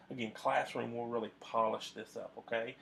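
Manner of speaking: normal talking voice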